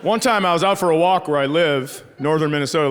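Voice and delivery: ordinary talk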